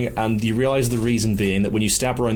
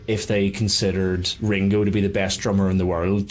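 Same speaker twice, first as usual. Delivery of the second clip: usual speaking voice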